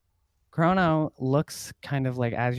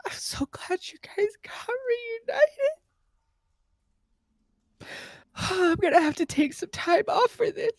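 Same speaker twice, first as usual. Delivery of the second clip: mock sobbing, much higher; hammed-up weeping. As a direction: gush through fake tears. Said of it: "the familiar man from the gate is just like".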